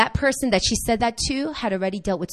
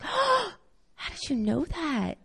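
jumps up high on "Oh" in breathy wonder; amazed gasp; 5 semitones higher, 7 dB quieter, slower than usual. Retold or imitated. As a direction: gasp and marvel at her. Amazed at girl's prophecy.

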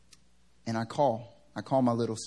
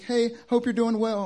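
high, sweet mock mom; 11 semitones higher, 5 dB louder than usual. Son imitating his mom's cheery late-night text, high and sweet. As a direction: chirp it like mom texting at 3am.

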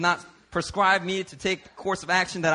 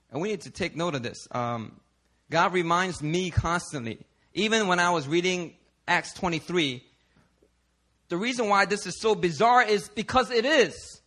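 normal talking voice

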